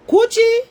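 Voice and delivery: "Gucci" called out high and loud; emphatic stress on Gucci. Real, not acted. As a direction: hammer the name "Gucci" to set her straight. Angry rant correcting a woman.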